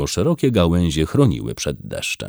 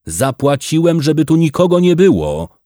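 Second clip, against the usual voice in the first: higher and louder, outraged; indignant raised voice; 9 semitones higher, 5 dB louder than usual. A narrator's reading. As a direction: protest it like a cheated landlord. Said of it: "oburzył się Bernard".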